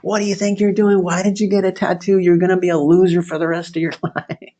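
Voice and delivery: high-pitched scolding, no real shout; mock screaming. Relatives scolding son over his tattoo, mock screaming. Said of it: "there was screaming and pandemonium".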